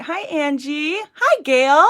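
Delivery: bright high hello; cheerful greeting. Real, not acted. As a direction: sing out a hello to viewers. Greeting chat viewers.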